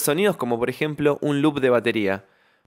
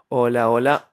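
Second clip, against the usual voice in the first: noise vocoder; 3 semitones lower than usual. Shown as a demonstration. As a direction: speak through a wash of noise. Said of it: "Vamos a probarlo".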